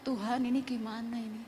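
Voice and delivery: hushed, helpless appeal to God, retold; helpless prayer. Mother asking God what to do, hushed helpless prayer. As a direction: softly re-enact a worried prayer.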